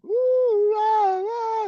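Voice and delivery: high, loud drawn-out whoops; mock karaoke whooping. Man imitating karaoke lovers going wild, high mock whooping. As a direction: imitate excited singers wailing away. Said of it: "They're like".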